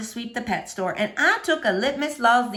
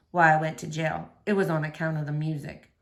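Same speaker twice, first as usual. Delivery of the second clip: low soft Otis; 8 semitones lower, 4 dB quieter than usual. A narrator's reading. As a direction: lower and softer, a lonely gentle man. Otis's voice continues.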